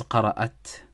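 قرأت read "qara'at", ending in "at"; she-form reading. Shown as a demonstration. Speaking about Maryam, so "qara'at" for قرأت. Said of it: "it has to be read as".

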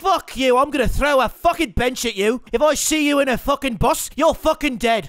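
cocky mock Irish accent, lightly done; a gamer playing brash Irish fighter Conor McGregor; swaggering put-on Irish brogue. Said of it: "My name is fucking Conor McGregor".

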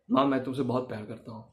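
soft, low loving line to mom; tender to mother. Shown as a demonstration. Son softly tells mother he loves her, tender. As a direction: gently tell your mother you love her. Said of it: "जब आप उनको कहेंगे कि".

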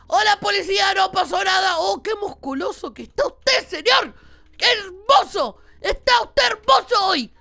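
high, loud fawning call; overdone mock flattery. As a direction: gush loudly at a policeman to cover up. Loudly flatters in-game policeman while hiding find. (halago fingido a gritos)

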